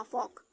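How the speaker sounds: "फॉक" with no l before the k; silent L. Shown as a demonstration. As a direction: say "फॉक", vowel straight into k. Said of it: "एल silent है".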